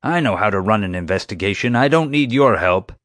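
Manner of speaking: testy security chief. Acted. Security chief refuses the adviser's help, testy. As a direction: rebuff the help, building in force.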